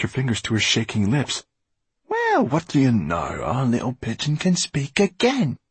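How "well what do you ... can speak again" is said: high, swooping taunt from a villain; mocking. A narrator's reading. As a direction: jeer at her, pitch sliding up and down. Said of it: "Basta's mocking voice".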